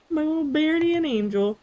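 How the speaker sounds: high, sing-song granny; doting granny voice. Acted. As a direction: gush it like a grandma. Repeats in exaggerated higher voice.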